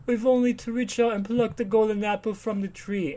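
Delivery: high-pitched, pompous society gentleman; mock upper-class socialite. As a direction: declaim like a caricature of the idle rich. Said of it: "really upper class, like, Manhattan socialites".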